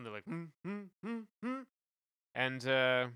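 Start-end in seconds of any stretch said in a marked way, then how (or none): 0.2-1.6 short, higher, soft hums; light hummed imitation of instruments; imitated string notes. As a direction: hum separate little notes softly and high.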